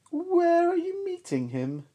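sly snake. Acted. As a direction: lean in sneaky and high. Snake asks.

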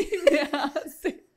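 words squeezed out while laughing; laughing through words. Real, not acted. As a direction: keep laughing as you speak. Laughing at daughter's question. (hablando entre risas)

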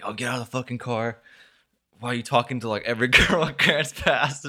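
lightly voiced jeering guys; mock taunt. Retelling the guys yelling at his car, mock taunt. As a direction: tease him like the bullies did. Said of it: "they're all saying like".

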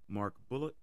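ordinary talk, nothing marked

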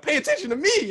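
high, loud imitation of a jealous girl; mock jealous demand. Acting out jealous girl demanding attention.

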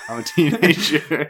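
laughing while talking. Real, not acted. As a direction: say it through a laugh. Laughing at own voice crack.

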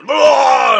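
loud high cry of pain; hit hard crying out. Retold or imitated. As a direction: imitate a sharp pained cry. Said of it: "he starts throwing up".

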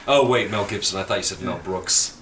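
ordinary delivery

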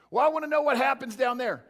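mock whiny listener pressing the pastor; a mock congregant begging the pastor for the whole plan; demanding congregant. Congregant voiced asking for more.

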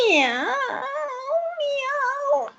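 a rising, high sung meow; meowing song for the cockroach; sung cat meows. Acted. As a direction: croon it like a tomcat serenading. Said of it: "comenzó a cantar".

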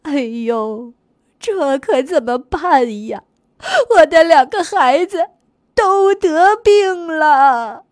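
a touch of sobbing, drawn out; crying. A narrator's reading. Cow mother grieving over her sick calves, tearfully. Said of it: "牛妈妈哭着说".

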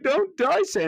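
put-on high, loud child's plea; little girl pleading. Little girl begging Santa not to die, high pleading. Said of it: "Trudy's like".

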